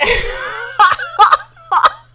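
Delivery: loud high burst of laughter. Real, not acted. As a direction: crack up at yourself.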